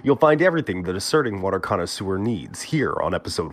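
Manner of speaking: mock-grand show host intro; podcast announcer voice. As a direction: read it like a pompous radio host.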